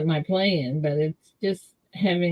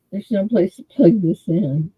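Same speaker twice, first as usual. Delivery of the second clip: ordinary delivery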